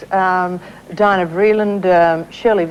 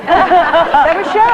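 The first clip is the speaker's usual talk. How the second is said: laughing; 8 semitones higher, 5 dB louder, slower than usual. Real, not acted. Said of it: "reacting to accent".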